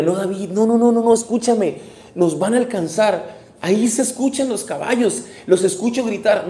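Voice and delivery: súplica urgente, aguda y fuerte; alarma actuada. Acted. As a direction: advertir con apremio, como un soldado acosado. Men urgently warn David of pursuers. (pánico dramatizado en voz alta)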